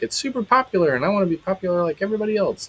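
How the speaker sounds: high and quick, a mock whiny teenager; a touch of a sing-song junior-high brat; mocking whiny teen. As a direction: parody a kid who just wants to fit in. Parents mocking kid's reasons.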